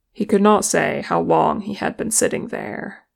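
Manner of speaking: usual speaking voice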